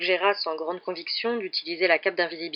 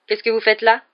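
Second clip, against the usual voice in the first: lighter, higher, rising question; friendly question; 4 semitones higher, 7 dB louder than usual. A narrator's reading. Professor finds pupil in the corridor and asks, friendly. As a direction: ask with mild curiosity, no accusation. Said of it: "d'un ton très différent de celui de Rusard".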